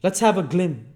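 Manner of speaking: loud, high gruff demand; Sikes's loud voice. Acted. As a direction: bark for a light.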